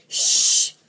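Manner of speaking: loud, long shush. Real, not acted. Vlogger to barking dogs, a loud shush. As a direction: hush the barking dogs with a forceful shh.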